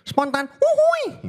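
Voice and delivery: very high, slow, stretched silly squeal; template voice. Pretend. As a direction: squeal it out like a cartoon. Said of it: "impersonate suara orang gitu". (suara template yang sangat tinggi dan lambat)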